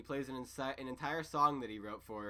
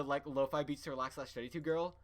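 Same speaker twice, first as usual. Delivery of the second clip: usual speaking voice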